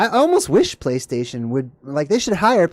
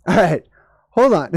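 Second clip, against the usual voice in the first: normal talking voice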